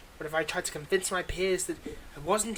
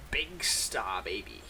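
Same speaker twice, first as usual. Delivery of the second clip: much lower, slow breathy announcer growl; dramatic deep whisper; 10 semitones lower, slower than usual. Acted. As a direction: unveil the name like a movie trailer. Villain announces new nickname.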